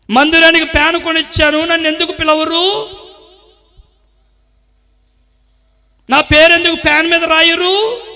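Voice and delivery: mock a believer fishing for credit; petty grumbling. Preacher mocking a donor whining to God, hammed-up grumbling. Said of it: "ఇదే గోల దేవుడి దగ్గర".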